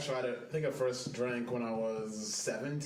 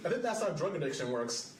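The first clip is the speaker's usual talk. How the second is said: normal talking voice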